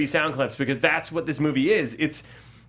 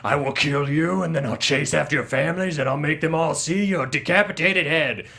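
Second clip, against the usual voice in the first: mock Batman threat. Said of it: "he's like".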